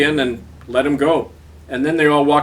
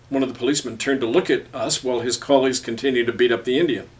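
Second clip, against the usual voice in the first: ordinary delivery, nothing marked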